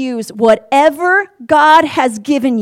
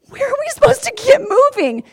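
much higher, amazed disbelief; baffled question; 12 semitones higher than usual. Pretend. Crowd at the sea asking where to go, baffled. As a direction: ask it like it's absurd.

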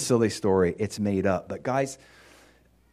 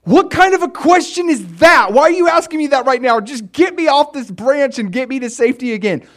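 much higher and loud, frantic; panicked yelling; 14 semitones higher, 10 dB louder than usual. Retold or imitated. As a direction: shout back in panic from the branch. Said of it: "And the guy's like".

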